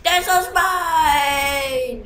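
long, high, loud call-out; alarmed shout. Real, not acted. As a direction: yell a drawn-out warning to the team. Calling out enemy spy.

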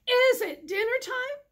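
high, loud goat call. Acted. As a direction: shout it across the hill like a goat. Goat calls out.

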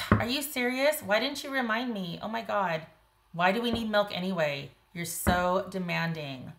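exasperated mock outburst, starting high and easing down; blame-shifting, demanding. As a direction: turn it back on the partner, hammed up. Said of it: "it's blame shifting".

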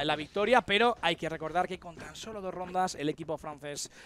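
ordinary talk, nothing marked

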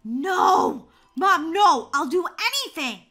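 loud, pleading outburst; desperate protest. Acted. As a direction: cry out against the bad news. Protests repeating the grade.

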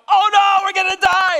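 high, loud hammed-up wail; hammed-up cowardly shriek; mock panic. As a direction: overplay a terrified whine. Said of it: "And does he go".

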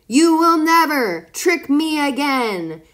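loud and slow, shouted back; howling vow. A narrator's reading. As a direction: bellow the vow while running away. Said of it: "he howled as he ran".

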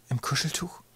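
half-whispered, very soft asking; small hushed voice rising in pitch; hushed question. A narrator's reading. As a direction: whisper it, stunned. Boy hushed by the revelation.

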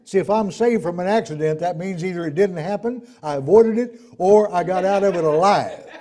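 loud, driving sermon; forceful preaching. Real, not acted. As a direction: drive the argument home loudly.

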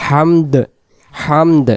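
হামদ with the closing দ clearly released; full final d. Shown as a demonstration. Teacher showing the correct word, fully pronounced ending. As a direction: finish হামদ on an audible d after the m. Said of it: "এরকম বলতে হবে".

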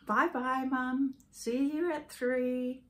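put-on high, light child's voice; imitating young son. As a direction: chirp it like a carefree little boy. Mother retells son's school goodbye.

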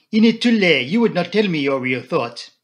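put-on loud, high French-accented dismissal; Poirot. A narrator's reading. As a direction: cut in as a Belgian detective. Poirot cuts in.

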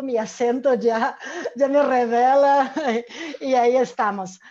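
laughing through the words; amused self-deprecation. Real, not acted. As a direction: chuckle loudly at your own giveaway accent. Laughs at her own accent.

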